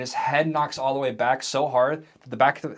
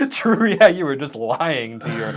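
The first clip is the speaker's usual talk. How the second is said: real laughter breaking into the words; laughing; 3 semitones higher, 8 dB louder, slower than usual. Real, not acted. Game master to player who lied to a friend, laughing. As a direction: talk while cracking up.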